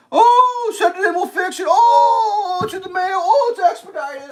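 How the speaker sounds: very high, loud mocking singsong; hammed-up mocking of the agents; shrill mocking rep. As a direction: parody their empty promises. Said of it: "telling me".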